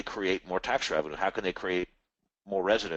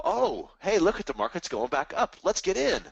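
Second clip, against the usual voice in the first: higher, perky imitation of eager buyers; mock eager investor; 8 semitones higher than usual. Mocking investors chasing the rebound, higher perky voice. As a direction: play the excited crowd rushing back in. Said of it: "People go".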